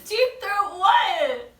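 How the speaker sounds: loud, slow, high disbelief; can't believe what she heard; incredulous. Real, not acted. Hearing the client's request.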